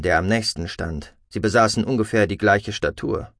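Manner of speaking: plain reading voice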